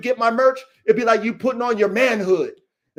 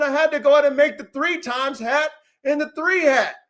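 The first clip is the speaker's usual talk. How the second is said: normal talking voice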